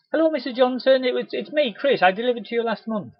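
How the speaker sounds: high, loud cheery hello; cheery greeting. Retold or imitated. Rep greeting a customer on her doorstep, cheery. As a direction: greet her warmly and brightly.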